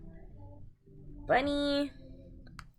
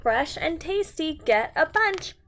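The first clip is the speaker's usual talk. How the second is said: slow, emphatic read-aloud with big sing-song swings; animated storytime voice; reading to children; 3 semitones higher, 3 dB louder, slower than usual. A narrator's reading. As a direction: read it out like a picture book for little ones. Reading picture book to children.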